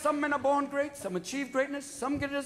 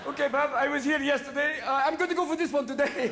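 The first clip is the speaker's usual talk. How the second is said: lightly put-on contestant voice; mock game contestant; 3 semitones higher than usual. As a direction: play a volunteer stepping up for a game. Voices a villager playing along.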